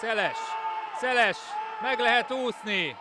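high urgent calls; excited sports commentary. Real, not acted. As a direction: urge the player on. Live play-by-play attack. (izgatott sürgető kommentár)

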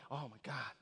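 low, soft imitated groan of reluctance; mock put-upon muttering with a heavy sigh; exasperated sigh. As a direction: imitate someone dreading it under their breath. Voicing reluctant people at altar call.

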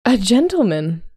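high, loud, thrilled mother; exclaiming. A narrator's reading. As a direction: exclaim it in overjoyed amazement. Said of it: "her mother exclaimed".

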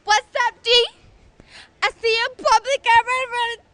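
eshay slang. Acted. Eshay shouting greetings loudly.

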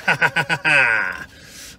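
loud, delighted chuckle; excited laugh. Real, not acted. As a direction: laugh with glee at the food. Laughs at food.

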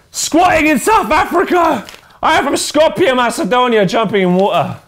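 high, loud, excited roll call; pumped-up high fan shout-outs; hyped shout-outs. Acted. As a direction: hype each fan's name up.

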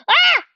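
sudden high yelp of alarm; startled shout. Real, not acted. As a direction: yelp as you get jumped. Ambushed, shouts.

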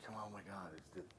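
under-the-breath, half-whispered amazement; very soft, whispered thought to oneself; hushed disbelief. Retold or imitated. Actor recalls putting the costume on, hushed disbelief.

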